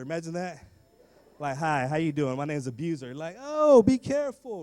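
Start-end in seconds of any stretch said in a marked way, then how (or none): 1.6-3.1 mock introduction. Imagined introduction. 3.4-4.6 high, loud, slow drawn-out warning; alarmed bystander. Retold or imitated. Stranger hearing the name Abuser, loud slow warning. Imagined listener reacts.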